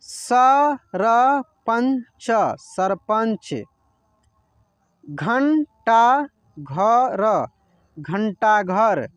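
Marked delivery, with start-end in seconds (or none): 0.0-2.3 sarpanch drawn out as स-र-पंच, very slowly; slow syllable reading. Shown as a demonstration. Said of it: "जैसे जैसे मैं पढ़ रहा हूँ ना". 5.0-7.5 घंटा घर stretched slowly with weight on घंटा; slow syllable reading. Shown as a demonstration. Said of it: "जैसे जैसे मैं पढ़ रहा हूँ ना".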